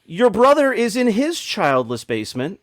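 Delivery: normal talking voice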